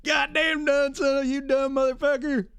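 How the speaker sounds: hammed-up worker screaming; mock shouting. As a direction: imitate furious coworkers.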